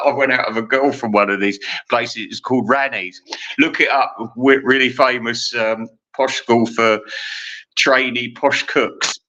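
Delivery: ordinary delivery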